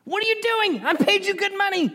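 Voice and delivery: high-pitched comic indignation; outraged king. Acted. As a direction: protest in a squealing put-on voice. Angry king protests second time.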